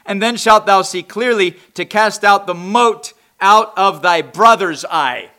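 loud, emphatic preacher reading verses; forceful scripture reading. Real, not acted. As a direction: read scripture aloud at full pulpit force. Reads scripture forcefully from pulpit.

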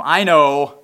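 loud, curt brush-off; dismissive I know. Retold or imitated. Preacher mimicking Martha's impatient reply. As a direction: snap it back like an impatient sister. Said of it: "notice her attitude".

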